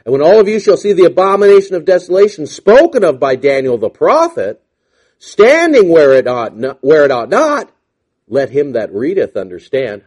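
loud preached scripture; preaching. Acted. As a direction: proclaim the prophecy. Preaching the verse.

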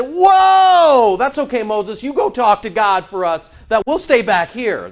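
high, loud startled backing off; mock alarm as they back away from God; frightened people. Israelites at Sinai begging Moses to speak instead, startled and loud. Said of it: "they said".

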